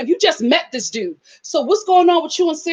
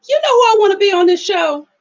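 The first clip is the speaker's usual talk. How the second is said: imitating mom; 7 semitones higher, 4 dB louder than usual. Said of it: "My mom gonna say".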